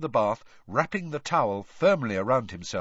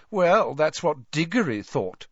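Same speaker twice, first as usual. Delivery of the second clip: smirking; 6 semitones higher, 5 dB louder than usual. Acted. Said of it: "who smirked at him".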